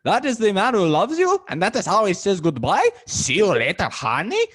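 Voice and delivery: high, loud put-on opera tenor voice. Host imitates [name] mocking [name]'s goodbye, high and loud. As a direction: ham up the pompous tenor. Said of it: "That's the best [name] voice I can do".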